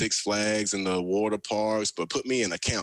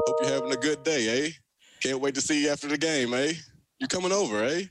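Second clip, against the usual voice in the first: imitating Canadians with a higher, sing-song eh at the end of each line; 5 semitones higher than usual. Ex-Alabama player telling radio host how Canadians talk, playful imitation. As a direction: tack a bright rising eh onto everything, lightly mocking. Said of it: "everybody I talked to was a".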